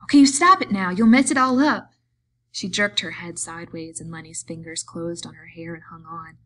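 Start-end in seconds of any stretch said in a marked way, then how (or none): 0.0-1.9 high, loud, angry protest; furious raised-voice scolding; angry cry. A narrator's reading. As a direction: cry out angrily to make him stop. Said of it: "then she cried angrily".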